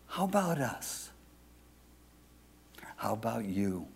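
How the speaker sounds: very soft, sinking to a whisper; hushed challenge. Real, not acted. Preacher turns the question on the listeners, hushed. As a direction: drop low and put it to them quietly.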